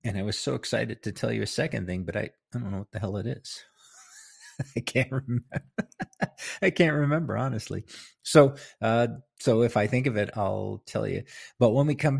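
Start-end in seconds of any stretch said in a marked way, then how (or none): 4.1-7.4 real laugh breaking through the words; higher-pitched, laughing at your own forgetfulness; can't get it out for laughing. Real, not acted.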